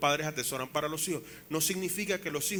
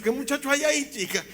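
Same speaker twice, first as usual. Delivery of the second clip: much higher, loud incredulous retort; mock disbelief; 11 semitones higher, 6 dB louder than usual. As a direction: toss the question up high. Retorting to mother.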